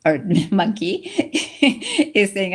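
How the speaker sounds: laughing at her own slip; a real laugh breaking in. Real, not acted.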